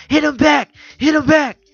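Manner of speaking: very high, loud yelling uncle; shouting. Retold or imitated. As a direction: yell it from the front row. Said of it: "my uncle stands up in the first row".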